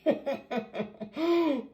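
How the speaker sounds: long, high disbelieving laugh; laughing so as not to panic; real helpless laugh; nervous laugh. Real, not acted. Laughing at ruined hair.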